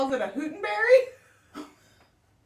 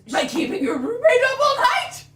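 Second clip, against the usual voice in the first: laughing retort; 8 semitones higher than usual. Retold or imitated. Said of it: "repeats her retort".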